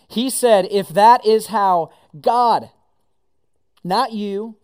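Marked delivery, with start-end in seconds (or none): 0.7-2.7 "God" hit loud and slow; stress on God. Shown as a demonstration. Pastor correcting the verse, stressing "God". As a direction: lean hard on "God". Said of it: "here he's gonna help us out".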